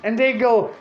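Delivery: ordinary delivery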